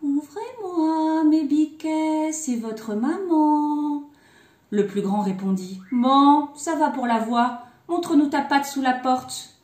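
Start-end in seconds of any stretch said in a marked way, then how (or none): 0.0-4.0 high, very slow singsong; sweet disguised voice. A narrator's reading. Wolf posing as mother with floured paws, sweet singsong. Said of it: "Il dit d'une voix toute douce". 5.9-9.6 loud, firm demand; challenging eldest kid. A narrator's reading. Eldest kid asking wolf for the paw, loud demand.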